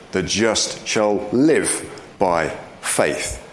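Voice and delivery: very slow, with "live" singled out by pauses; stress on LIVE. Shown as a demonstration. Preacher showing the emphasis in Hebrews, stress on "live". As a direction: set "live" apart and lean on it. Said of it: "the emphasis seems to be on live".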